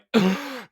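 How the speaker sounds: sharp high gasp; demonstrated gasp. Shown as a demonstration. Speaker shows how she stops mid-block, sharp gasp. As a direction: suck in a loud, sudden breath.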